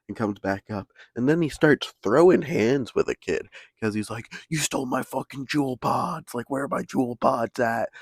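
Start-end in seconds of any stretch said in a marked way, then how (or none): 4.5-7.9 a higher-pitched accusing rant; hammed-up blame of a thief; imitated angry accusation. Friend accusing a kid over pods.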